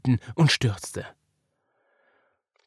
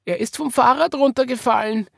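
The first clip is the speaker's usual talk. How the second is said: put-on high, raised anxious child voice; fretful little girl, pitched up; worried; 12 semitones higher, 5 dB louder than usual. A narrator's reading. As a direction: report it with childlike concern. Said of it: "sagte Marie besorgt".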